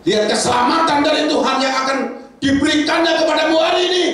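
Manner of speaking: high, loud preacher's proclamation; preaching at full force. Acted. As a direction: proclaim it to the whole church. Preaching the Exodus passage. (khotbah lantang dengan suara tinggi)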